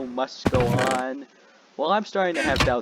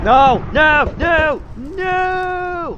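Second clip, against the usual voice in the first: repeated screams, much higher and much louder; real shout of despair at losing; anguished screaming no; 12 dB louder, much slower than usual. Real, not acted.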